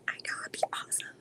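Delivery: breathy hushed aside; whispered to herself with excitement. Real, not acted. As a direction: drop to a secret whisper. Hushed aside about dream match.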